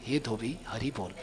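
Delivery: soft, tender plea, close to a murmur; with great love. Retold or imitated. Mahaprabhu softly coaxing the washerman with love. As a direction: call him gently, full of devotion. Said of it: "इतने प्रेम से बोल रहे हैं".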